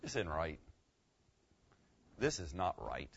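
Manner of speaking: low, soft dawning regret; hushed "This is not right"; quiet realization. Shown as a demonstration. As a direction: let the truth sink in quietly. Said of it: "I would say something like this".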